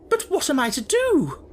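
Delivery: high, loud indignant headmistress; indignant demand. Acted. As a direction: demand it, outraged and shrill. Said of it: "demanded Miss Minchin".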